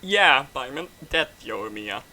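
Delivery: ordinary talk, nothing marked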